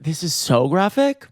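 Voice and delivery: usual speaking voice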